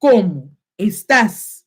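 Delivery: slow, over-enunciated learner phrases; exaggerated pronunciation. Shown as a demonstration. Teacher showing how nobody really talks, exaggerated and slow. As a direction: spell out each syllable with heavy, drawn-out stress. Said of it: "That's not what I'm saying".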